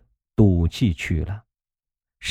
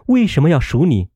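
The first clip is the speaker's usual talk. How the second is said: stunned; 8 semitones higher, faster than usual. A narrator's reading. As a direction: demand it in dismay, fast. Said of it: "越发怔了".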